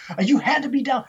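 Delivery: high, agitated accusation; snapped, insistent. Retold or imitated. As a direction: insist on it, pitch way up. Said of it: "He just snapped".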